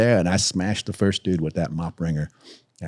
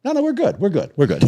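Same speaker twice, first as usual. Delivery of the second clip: much higher, hasty and placating; scared backing down; 12 semitones higher, 4 dB louder than usual. Retold or imitated. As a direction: squeak out a nervous retreat. Tough crowd suddenly backing down.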